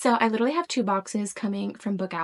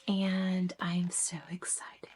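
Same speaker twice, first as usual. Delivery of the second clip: whispered thrill; excited whisper; 4 semitones lower, 10 dB quieter, slower than usual. Real, not acted. Hushed excitement before louder repeat.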